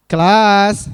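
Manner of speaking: loud call for attention; calling the class. Real, not acted. Teacher calling out loudly to get the class's attention. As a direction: call out to the room.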